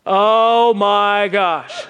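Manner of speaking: loud, drawn-out yell, slow; slurry loud shout of disbelief; drunken yell. Retold or imitated. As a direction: stretch it into a loud drunk bellow. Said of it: "He's yelling".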